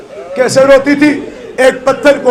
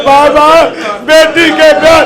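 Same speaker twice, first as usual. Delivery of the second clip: high, loud grieving call; keening lament voice; tearful cry to father; 5 semitones higher, 4 dB louder than usual. Acted. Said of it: "رو کے کہتی ہے".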